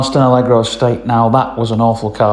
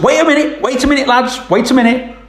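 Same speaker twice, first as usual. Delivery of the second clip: farmer's wife calling; 12 semitones higher, faster than usual. Retold or imitated. Farmer's wife calling them back.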